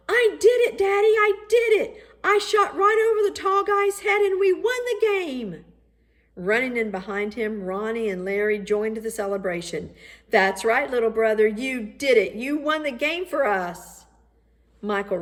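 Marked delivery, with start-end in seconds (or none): 0.1-5.6 put-on much higher and louder joyful boy's shout; excited shout. A narrator's reading. Michael bursting through the door to tell Daddy, shouting with joy. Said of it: "he shouted". 10.3-14.1 louder, higher celebration; cheering brothers. A narrator's reading. Big brothers joining the celebration, cheering proudly.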